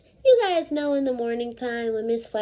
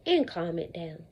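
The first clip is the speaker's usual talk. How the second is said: soothing low; 9 semitones lower, 6 dB quieter than usual. Retold or imitated. Voicing teacher's calming advice.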